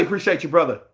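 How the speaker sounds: ordinary delivery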